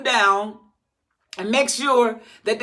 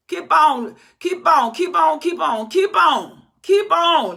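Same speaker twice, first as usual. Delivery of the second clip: loud, higher mocking keep on; mock urging; 6 semitones higher, 4 dB louder, slower than usual. Preacher mocking the congregation's habits, loud sarcastic urging. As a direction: goad them sarcastically, loud and high.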